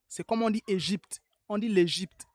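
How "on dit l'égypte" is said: normal talking voice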